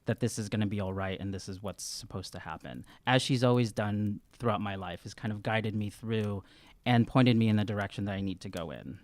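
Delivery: usual speaking voice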